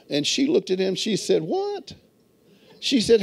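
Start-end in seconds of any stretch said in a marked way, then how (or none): 1.4-2.0 high, soft incredulous question; imitated disbelief, pitched way up; mock disbelief. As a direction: act out a wife taken aback. Wife reacts to husband's bossy order.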